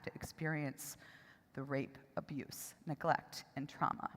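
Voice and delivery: hushed, low, choked-up gratitude; real tearful quiet; close to crying. Real, not acted. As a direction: speak softly through rising tears, slipping into near-whisper. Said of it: "I'm gonna cry every time".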